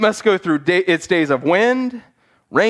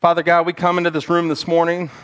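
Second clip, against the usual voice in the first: prayer; 3 semitones lower than usual. Acted. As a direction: pray gently over the room. Prayer over music.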